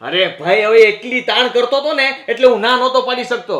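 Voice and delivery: ordinary delivery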